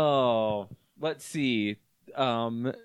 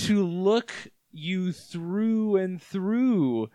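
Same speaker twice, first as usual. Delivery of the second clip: slow, eerie monster reply; slow creature voice; 2 semitones higher, much slower than usual. Acted. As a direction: answer like a lurking creature. Creature answers boy.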